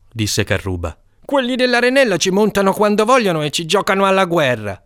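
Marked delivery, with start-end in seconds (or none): 1.3-4.8 put-on high, loud boyish argument; boastful kid. Carruba convincing the gang, high and loud. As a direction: insist like a know-it-all boy.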